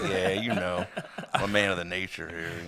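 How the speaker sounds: low, soft smug nature-man voice; cool guy. Retold or imitated. Mocking a guy acting cool for girls, low and smug. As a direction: play it deep and laid-back to impress. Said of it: "You're like".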